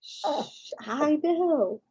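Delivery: breathy hush, then a soft murmur; quiet him down gently; whispered soothing to a dog; shushing whisper. Real, not acted.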